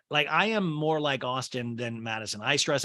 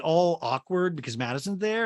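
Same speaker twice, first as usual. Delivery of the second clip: normal talking voice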